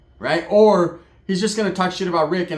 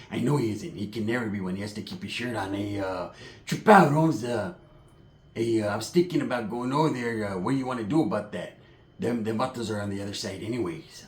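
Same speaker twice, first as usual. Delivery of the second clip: imitating Pepe; 5 semitones lower, 6 dB quieter than usual. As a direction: drag it out, low and cold. Pepe voiced in the story.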